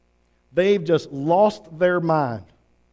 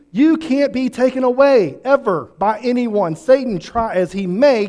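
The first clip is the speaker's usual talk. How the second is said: fiery preaching; 4 dB louder than usual. Acted. Pastor assuring believers they are safe, preaching with full force. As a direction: preach it out, hammering each line.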